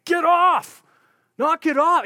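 high, loud yell at someone; yelling, screaming. Acted. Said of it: "I'm picturing him yelling, screaming".

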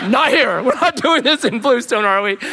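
high, loud comic objection; mock panicked protest. Companion begging Moses not to repeat it, mock panic. Acts out louder protest, audience laughing.

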